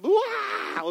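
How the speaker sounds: high, drawn-out mock scream of falling; mock falling yell. Preacher acting out one misstep off a cliff, mock falling scream. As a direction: yelp "wah" as if dropping off a ledge.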